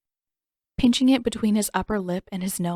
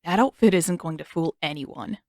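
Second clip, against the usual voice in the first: plain reading voice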